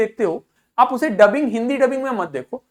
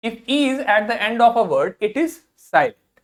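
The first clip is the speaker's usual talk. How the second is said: slow dictation; much slower than usual. Shown as a demonstration. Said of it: "teacher reading rule slowly".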